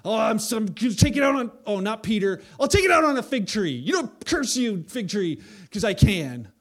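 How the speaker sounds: loud, high, mock hangry tantrum. Preacher mocking a hangry Jesus, shouting tantrum. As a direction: rant like a sulky bully picking a target. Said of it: "a temper tantrum where he's like".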